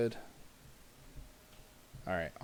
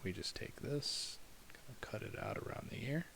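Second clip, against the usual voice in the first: whisper; 11 dB quieter than usual. Real, not acted. Animator whispering while working.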